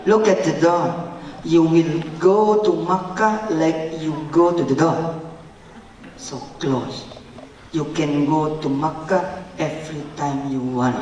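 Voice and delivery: slow, measured English; slow, hushed assurance, word by word; solemn mother's promise. Retold or imitated.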